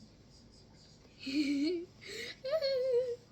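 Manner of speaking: loud high-pitched giggly squeal; high squealing laugh. Pretend. Threatening the stuck slime, high squealing laugh. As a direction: squeal with rising, drawn-out laughter.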